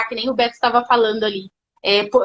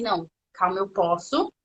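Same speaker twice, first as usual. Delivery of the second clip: calming self-talk; 7 semitones lower, 6 dB quieter than usual. Retold or imitated. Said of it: "eu falo assim".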